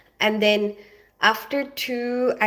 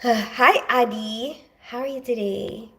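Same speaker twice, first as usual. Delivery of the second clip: normal talking voice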